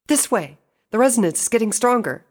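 high, loud, excited call; triumphant cry. A narrator's reading. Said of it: "That triumphant cry".

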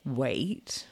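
low, soft "wait" with a rising end; probing question. A narrator's reading. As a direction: probe with a low, quiet, questioning echo. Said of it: "I probe".

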